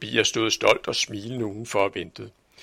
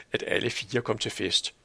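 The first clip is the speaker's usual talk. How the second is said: usual speaking voice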